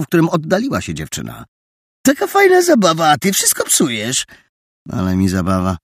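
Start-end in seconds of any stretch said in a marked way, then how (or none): 2.0-4.2 pretensja. A narrator's reading. Adaś z pretensją. 4.9-5.8 lekkie prychnięcie. A narrator's reading. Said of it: "prychnął Rafał".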